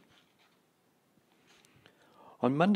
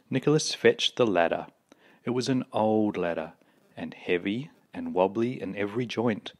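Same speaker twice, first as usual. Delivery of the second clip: plain reading voice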